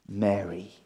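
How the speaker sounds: very soft, low, gentle "Mary"; tender call of her name. Acted. Risen Jesus gently speaks Mary's name, tender and hushed. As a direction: say her name like a quiet revelation.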